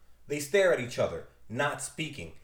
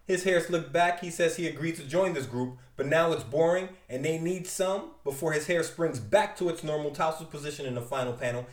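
ordinary talk

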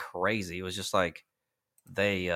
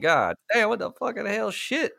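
exclamation stupéfaite nettement plus aiguë; choc rejoué; 11 semitones higher than usual. Retold or imitated. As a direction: rejoue ta stupeur devant l'accident. Acted shocked reaction to crash.

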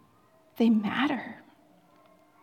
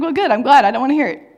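mock loud retort; 3 semitones higher, 11 dB louder, much faster than usual. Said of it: "And I was like".

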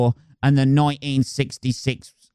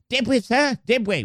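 higher, eager sidekick voice; plucky cockney sidekick; 8 semitones higher than usual. Acted. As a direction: play the keen little cockney helper. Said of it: "the plucky fucking cockney sidekick".

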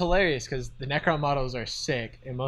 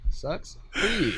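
taunting; slower than usual. Retold or imitated. Mock taunt at the opponent.

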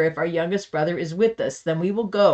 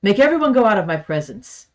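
loud, higher commanding cry; 5 semitones higher, 6 dB louder than usual. A narrator's reading. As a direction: order everyone out, voice raised. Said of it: "so he cried out".

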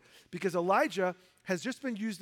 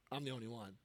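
mock powerful; 8 semitones lower, 12 dB quieter, faster than usual. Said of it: "which sounds, like, powerful".

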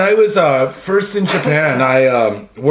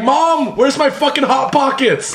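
yelling across the room; 8 semitones higher, slower than usual. Retold or imitated. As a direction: yell it to mom in the next room. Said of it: "he's like".

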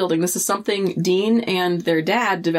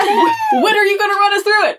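high, fast, laughing eagerness; excited; 13 semitones higher, 4 dB louder than usual. Real, not acted. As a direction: pester them with glee. Eager demand after news.

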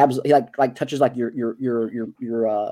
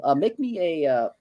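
ordinary talk, nothing marked

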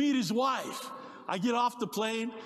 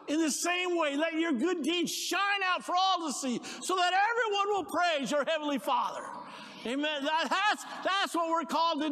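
fervent sermon crescendo; preaching climax; 8 semitones higher than usual. Real, not acted. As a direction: lift it up and proclaim.

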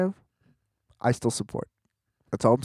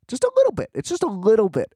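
high squeaked mock little bit; tiny sarcastic falsetto for a tiny thing; squeaky sarcastic understatement; 7 semitones higher than usual. Real, not acted. Sarcastic understatement about right-handers.

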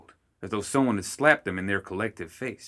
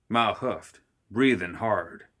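ordinary delivery, nothing marked

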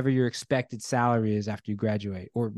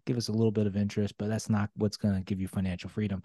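ordinary talk

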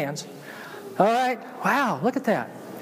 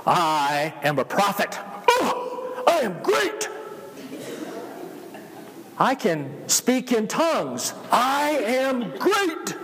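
boastful; 2 semitones higher, much slower than usual. Retold or imitated. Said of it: "Some of the people are sitting there saying".

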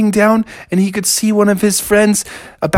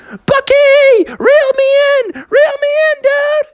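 very high, loud call from up high; Froggy calling down; 4 dB louder, slower than usual. Acted. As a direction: shout down to your friend for help. Froggy calls down to Bucky.

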